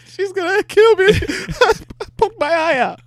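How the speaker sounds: very high, loud mock wail through laughter; laughing mock panic. Friend teasing the storyteller about mom, laughing mock panic. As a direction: mimic a scared child while cracking up.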